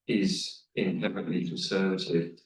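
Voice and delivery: usual speaking voice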